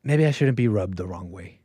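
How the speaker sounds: usual speaking voice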